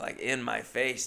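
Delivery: ordinary delivery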